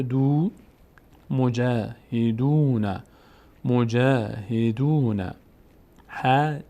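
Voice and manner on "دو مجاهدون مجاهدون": مجاهدون slowly, "jaa" and "doo" held; long vowels. Shown as a demonstration. Teacher showing dal before waw, long vowels. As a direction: stretch جا and دو in مجاهدون.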